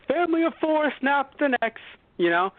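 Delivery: high, bright mock cheer; mock hero voice. Superman joking to the family, high mock cheer. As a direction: chirp it like an oblivious hero.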